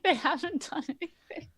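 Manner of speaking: usual speaking voice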